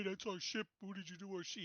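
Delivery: ordinary talk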